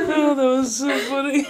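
high, giggling words; laughing. Real, not acted. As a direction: say it still laughing. Still laughing.

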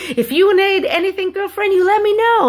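put-on high-pitched sassy girlfriend-to-girlfriend voice; high, animated retold bystander; emphatic offer of help.

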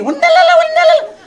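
very high mimicked holler; shouted question in reply. Woman answering her friend with a question, high mock shouting. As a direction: fire back high, loud gibberish. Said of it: "היא עונה לה בשאלה".

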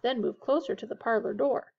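plain reading voice

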